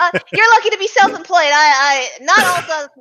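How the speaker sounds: high, quick, flustered accountant voice; nervous accountant. Acted. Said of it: "wrong voice again".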